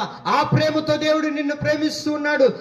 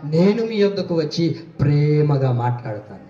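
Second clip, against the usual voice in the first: voice of Jesus; 8 semitones lower than usual. Retold or imitated. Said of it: "కానీ యేసయ్య అంటారు".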